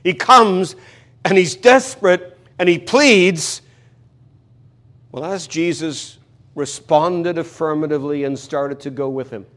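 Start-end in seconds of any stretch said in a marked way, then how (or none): none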